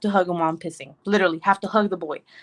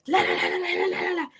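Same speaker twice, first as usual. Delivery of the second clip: imitated toddler babble; 7 semitones higher than usual. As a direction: babble la-la-la like a kid demanding attention. Imitates toddler waking her up.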